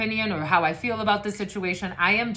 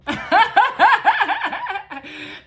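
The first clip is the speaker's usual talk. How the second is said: big shrieking burst of laughter; loud high laugh; 12 semitones higher, 8 dB louder than usual. Real, not acted. Tarot reader laughing at her own story, loud high laugh. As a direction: crack up loudly.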